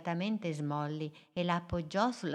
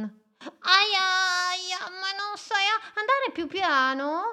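high, complaining ouch; hurt otter voice; 5 dB louder than usual. Acted. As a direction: yelp and scold, much higher. Otter bumped into.

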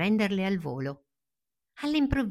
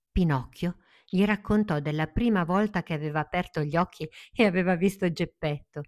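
plain reading voice

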